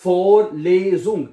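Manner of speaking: Vorlesung stretched slowly, each syllable clear; slow careful pronunciation. Shown as a demonstration. Teacher demonstrating Vorlesung pronunciation to class. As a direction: say Vorlesung slowly, every part sounded. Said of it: "इसकी pronunciation इस तरह आसान है".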